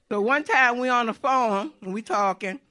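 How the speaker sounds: normal talking voice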